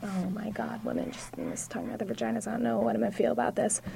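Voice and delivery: half-whispered, quick aside; hushed mock dismay. Audience member privately dreading a show about vaginas, hushed mock dismay. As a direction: murmur it fast, like an uneasy private thought. Acting out her doubtful first expectation.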